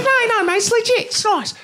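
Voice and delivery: little Aussie promoter in a tiny high voice; tiny excited Australian promoter voice; Australian accent. Retold or imitated. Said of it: "this little Australian guy".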